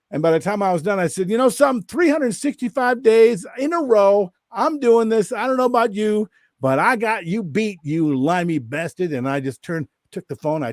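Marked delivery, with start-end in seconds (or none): 1.3-9.1 high, emphatic boast, dropping at the final insult; talking smack. Retold or imitated. Walker finishing his video challenge to a friend, mock-triumphant smack talk. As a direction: declare the dare grandly, then jab. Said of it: "I'm just talking smack to him".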